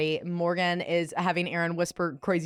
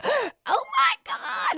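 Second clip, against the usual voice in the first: very high, slow gasped squeal; shrieking Oh my God; much slower than usual. Retold or imitated. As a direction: squeal it out like a starstruck fan. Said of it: "Morgan's like".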